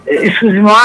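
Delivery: ordinary talk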